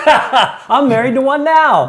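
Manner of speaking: loud, high, drawn-out laughing retort; laughing exclamation. Real, not acted. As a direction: burst out laughing and crow the joke. Laughing joke back at co-guest.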